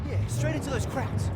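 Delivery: loud alarmed call. Acted. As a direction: call out over the noise. Nearing enemy camp under fire.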